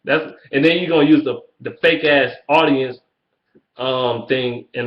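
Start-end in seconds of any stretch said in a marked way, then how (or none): none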